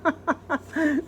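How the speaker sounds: high light chuckle; a brief amused laugh; man's chuckle. Acted. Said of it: "began to chuckle".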